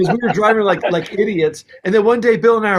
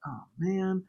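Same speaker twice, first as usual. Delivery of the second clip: deflated; 11 dB quieter than usual. Retold or imitated. Engineer told to erase the recordings he wanted to mix, deflated. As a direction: let the words sink out quietly, deflated.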